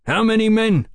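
high, loud barked question. A narrator's reading. Sergeant demanding numbers from his prisoner, barking. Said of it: "Fraser barked".

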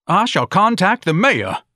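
high, slow, loud proclamation; loud outraged declaration; indignant shouting. A narrator's reading. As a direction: shout it out grandly, drawn out. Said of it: "Ignatius was shouting".

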